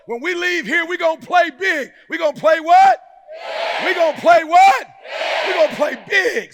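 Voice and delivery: high, loud shouted rally; call-and-response preaching. Real, not acted. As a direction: whip the crowd into answering.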